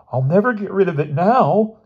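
high tearful wail. A narrator's reading. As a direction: wail it through tears. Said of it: "he wailed".

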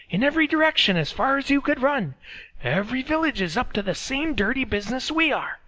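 high, loud, scolding; scornful villager. A narrator's reading. Villager rebuts, urges prayer.

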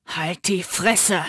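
low slow growl. Acted. Matteo growls at Mick, low and slow. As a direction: growl it through the bars. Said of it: "grollte Matteo".

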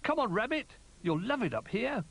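high, beckoning little bear; eager call. A narrator's reading. Little Bear calling Rabbit up, high and eager. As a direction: call down, pitched right up. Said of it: "he called".

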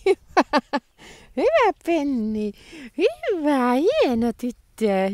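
high, slow doggy praise; praising the dog. Real, not acted. Praising dog who came back.

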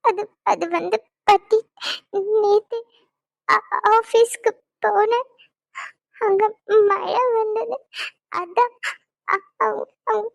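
slow, halting speech through sobs; crying. Acted. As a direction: break down as you try to explain. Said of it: "அழுகாதம்மா".

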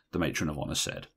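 plain reading voice

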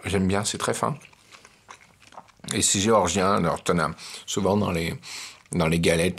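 talking while chewing food; speaking with food in mouth; mouth full, chewing. Real, not acted. Seen 2: Bites cheese at 1.1, then chews through remaining words.

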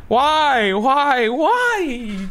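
high, wailing lament; anguished pleading. Real, not acted. Gamer lamenting another death, anguished wail. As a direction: cry out in rising despair. Player laments dying in game.

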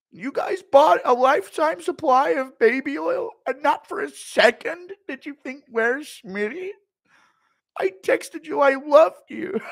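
high, very loud indignant rant; Schmitty rant. Retold or imitated. Streamer as Schmitty scolding partygoers, loud high rant. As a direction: yell it like a wounded ego.

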